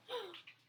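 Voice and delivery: sharp frightened gasp; startled gasp. Acted. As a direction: gasp at the noise. Tapping on roof.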